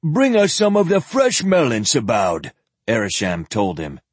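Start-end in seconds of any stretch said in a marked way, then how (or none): 0.0-2.5 higher, lightly voiced old zealot. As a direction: play the pampered old priest, a little higher. Zealot orders boy.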